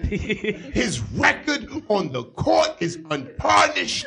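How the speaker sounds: bombastic pundit, drawn-out words; hammed-up praise with dramatic pauses; Stephen A. Smith. Pundit answering a question on Kobe Bryant, bombastic impression. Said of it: "Stephen A. Smith, is Kobe Bryant".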